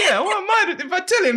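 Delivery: high, laughing disbelief; laughing coworker mocking the boss's gossip; laughing high retort. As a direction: toss it off through a laugh.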